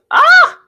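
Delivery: short, high, loud yelp; a sudden piercing "ah" of disbelief; shocked shriek. Real, not acted. Listener reacting to the customer service story, shocked shriek. Shocked exclamation at retold incident.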